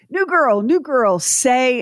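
lightly mimicked playground chant; kids taunting. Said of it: "icebreaker ... on the bus".

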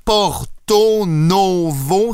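"Porto Novo" drawn out slowly, every O held long; stretched out. Shown as a demonstration. Quizzing Fred on a capital, slow stretched Porto Novo. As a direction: linger on each O of "Porto Novo". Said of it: "j'ai plein de O".

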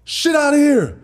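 high, punched shot-blocker's yell; retold on-court outburst, loud and high; angry trash talk. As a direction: cry it out high, each word separate. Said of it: "if I was just angry".

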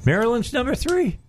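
high-pitched, incredulous echo question; surprised. Real, not acted. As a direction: repeat it back in real disbelief, pitch jumping up. Reacts to unexpected ranking.

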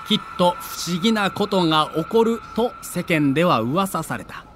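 usual speaking voice

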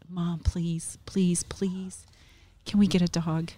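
mock plea with drawn-out pleases; pleading. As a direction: beg lightly, as a son working on his mom. Acted son begging for a dog.

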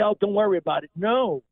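ordinary talk

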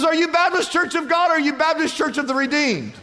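high-pitched acted question; hammed-up eager passerby. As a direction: press on excitedly for common ground.

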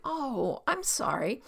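gentle, sympathetic "Oh, I'm sorry"; understanding, unforced apology; genuine apology. Shown as a demonstration. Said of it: "You're just like".

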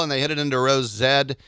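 normal talking voice

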